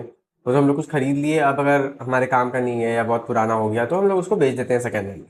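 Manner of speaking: ordinary delivery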